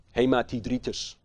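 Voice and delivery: usual speaking voice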